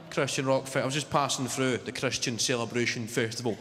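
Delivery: ordinary talk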